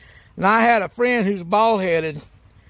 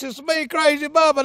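normal talking voice

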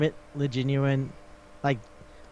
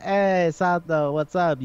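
drawn-out, raised greeting; mock chummy hail with a stretched hey; lightly voiced friend; 4 semitones higher, 4 dB louder, slower than usual. Friend greeting Sato before asking about his sister, lightly voiced chummy hail.

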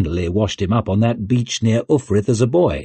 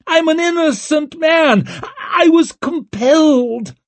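very high, drawn-out screech; desperate screech; 3 dB louder than usual. A narrator's reading. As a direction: screech your innocence while dragged off. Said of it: "He screeched over his shoulder".

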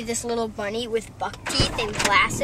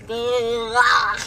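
louder guttural grunt; mock monster groan; possessed groan; 6 dB louder than usual. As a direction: growl it like a demon. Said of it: "She's like".

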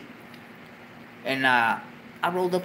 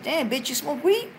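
high surprised question; amazed she smokes; 11 semitones higher than usual. Retold or imitated. To the girl asking for weed, high and surprised. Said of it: "I said".

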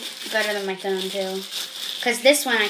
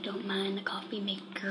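hushed unvoiced whisper; 4 semitones lower, 16 dB quieter than usual. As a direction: whisper it under your breath. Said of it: "He's like".

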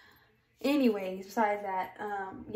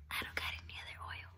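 fast whispered aside; 13 dB quieter, much faster than usual. Real, not acted. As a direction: whisper it quickly to yourself. Quick aside.

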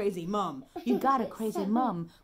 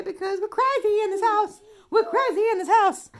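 high, quick, zany proclamation; playful craziness; 9 semitones higher, 3 dB louder, faster than usual. Pretend. Parent clowning so baby stops crying, zany and excited. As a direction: go giddy and high, rattling it out.

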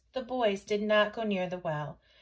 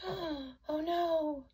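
gasp, then high, hushed "oh no"; dismayed gasp; 7 semitones higher, 4 dB quieter than usual. Acted. Storyteller reacting to Chang falling in, dismayed gasp.

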